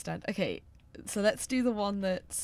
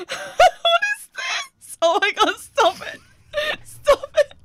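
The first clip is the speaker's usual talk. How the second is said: high squealing laughing protest; laughing shriek; 12 dB louder, slower than usual. Real, not acted. Shrieking at ridiculous taunt.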